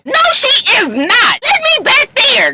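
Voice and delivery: very high, drawn-out demand; mock angry insistence. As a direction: refuse to back down, slowly. Lyrica insisting.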